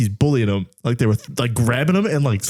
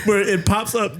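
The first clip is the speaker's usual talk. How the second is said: ordinary delivery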